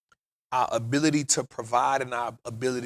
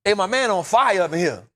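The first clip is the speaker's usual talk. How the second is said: high, loud urgent call to the guard; hollering for Sarge through the cell door; 7 semitones higher, 6 dB louder than usual. Retold or imitated. Calling the sergeant from a burning cell, high and loud. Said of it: "I'm like".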